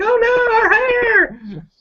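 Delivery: hammed-up squeal of horror; mock scream. Volleyball girl's friends caught in the rain, mock scream. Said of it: "her friends start to scream like".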